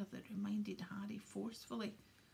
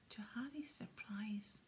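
plain reading voice

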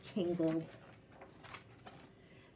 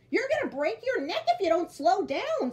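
high, loud, odd bird voice; a scolding little bird; Bird's voice; 11 semitones higher, 7 dB louder than usual. Acted. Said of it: "said Bird".

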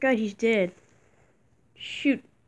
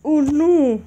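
normal talking voice

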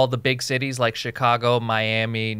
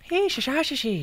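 attempted Irish accent; 14 semitones higher, 4 dB quieter than usual. Failed try at Irish accent.

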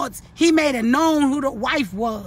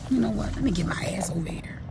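hushed aside to herself, breath only; whisper; 4 semitones lower, 8 dB quieter than usual. Real, not acted. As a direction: drop to a quick whisper as you shift away. Moving aside mid-stream.

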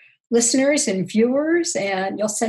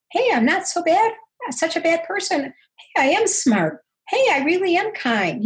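hopeful self-affirmation; 7 semitones higher than usual. Retold or imitated. Retold new self-view, bright.

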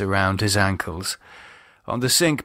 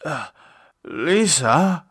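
confused; 6 semitones higher, slower than usual. Acted. David confused.

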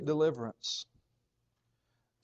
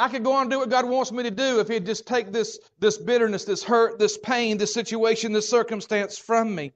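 pleading believer; 6 semitones higher, 5 dB louder than usual. Pretend.

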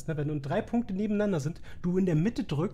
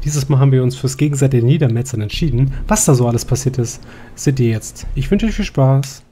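loud, upbeat host intro; YouTube intro; 4 semitones lower, 13 dB louder than usual. Acted. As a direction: greet your viewers like a show host. Video intro to viewers.

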